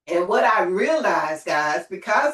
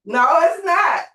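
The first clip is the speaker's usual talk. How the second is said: firm, rising call to the crowd; emphatic shout; 8 semitones higher, 6 dB louder than usual. Real, not acted. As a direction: shout it back high and loud. Affirming audience, shouting back.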